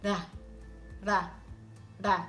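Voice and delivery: "Da" with one quick tongue tap on the palate; tongue tap demo. Shown as a demonstration. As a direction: tap "Da" lightly, tip of tongue. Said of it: "and you release the air".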